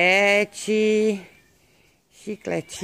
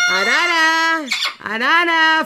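high-pitched call to a macaw; 7 semitones higher, slower than usual. Real, not acted. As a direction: call the bird in a high, lively voice. Calling out to the macaw. (chamando a arara em voz aguda)

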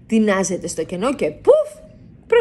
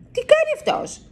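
even higher, disbelieving repeat of τι κάνει; the same question rising into amazement; astonished; 3 dB louder than usual. A narrator's reading. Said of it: "ρωτάει η Σούλα".

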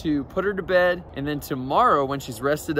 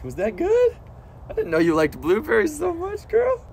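very high, loud pet talk; 14 semitones higher, 5 dB louder than usual. Real, not acted. Owner cooing praise at his possum, high baby talk. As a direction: coo up high at her.

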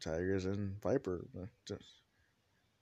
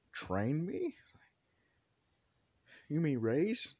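higher, questioning protest; offended son; 7 semitones higher, 4 dB louder, slower than usual. Acted. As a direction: query it in a pitched-up, affronted voice. Son objects to mother's word.

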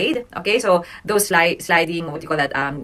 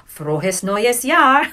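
Frohes neues Jahr quicker and brighter; closer to natural speed; 4 semitones higher, much slower than usual. Shown as a demonstration. Teacher moving the class toward normal speed. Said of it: "try to say it the same speed".

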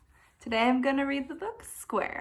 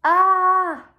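loud, high cry of alarm; a sharp loud shocked cry; cry of dismay; 8 semitones higher, 10 dB louder than usual. A narrator's reading. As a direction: cry out at the rubble. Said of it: "cried Square".

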